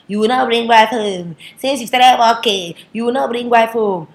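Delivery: loud, high parental scolding; nagging parent. Acted. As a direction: badger the son, leaning on every word. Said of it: "Parents start to stress their sons".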